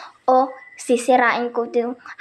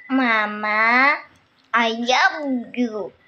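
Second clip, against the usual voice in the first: slow teaching voice; 3 semitones lower, much slower than usual. Shown as a demonstration. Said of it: "Sabihin mo".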